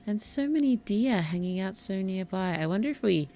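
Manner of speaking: normal talking voice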